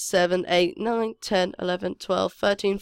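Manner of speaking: usual speaking voice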